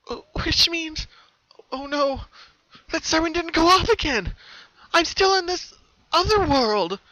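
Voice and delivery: pony realizes she is trapped, panicking. A narrator's reading. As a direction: spiral into panic. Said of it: "Pinky began to panic".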